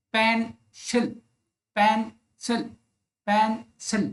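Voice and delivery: "पेंसिल" with a clear gap between both syllables. Shown as a demonstration. Teacher showing two syllables with jaw on hand, syllable by syllable. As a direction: split "पेंसिल" into two beats, pen, then sil. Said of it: "दो बार मेरी jaw मेरे हाथ से टकरा रही है".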